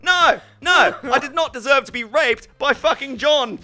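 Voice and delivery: high, loud outraged protest; mock outraged shout. Real, not acted. Telling a friend the story, a high loud mock-outraged shout.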